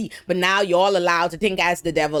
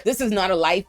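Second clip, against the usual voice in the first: normal talking voice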